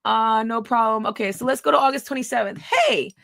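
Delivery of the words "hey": high hey. Retold or imitated. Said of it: "I said".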